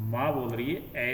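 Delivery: ordinary delivery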